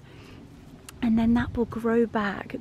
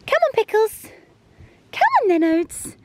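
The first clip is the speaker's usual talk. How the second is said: much higher, louder doggy call; calling the dogs; 11 semitones higher, 6 dB louder, faster than usual. Real, not acted. Owner urging her dogs on, high pitched call. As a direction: squeal them along.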